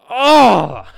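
a sudden high, loud "Oh!" like dying in a game; mock angry yelp. Gamer dying again, a hammed-up loud yelp. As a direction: burst out in fake frustration. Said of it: "and just like".

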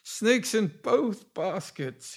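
confused, drawn-out, high-pitched babble; dazed mumbling. A narrator's reading. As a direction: ramble slowly in a muddled daze. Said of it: "he was mumbling".

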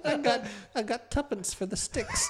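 high Cockney urchin voice; old English accent. Acted. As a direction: play a poor London street kid. Continues old English child bit.

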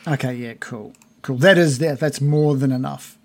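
normal talking voice

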